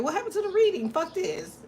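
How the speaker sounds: high, whiny imitation of annoyed viewers; mock viewer complaint. Streamer mocking her viewers' gripe, high and whiny. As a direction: squeak it out like a fed-up fan.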